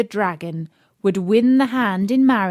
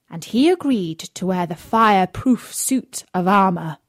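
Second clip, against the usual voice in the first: ordinary delivery, nothing marked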